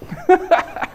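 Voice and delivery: short high-pitched chuckle; amused laugh. Real, not acted. Preacher amused by his own "woman" joke, chuckling.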